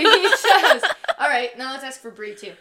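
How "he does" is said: laughing, loud and high. Real, not acted. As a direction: say it through laughter. Laughing after cheer.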